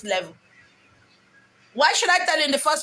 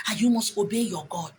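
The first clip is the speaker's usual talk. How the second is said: ordinary talk, nothing marked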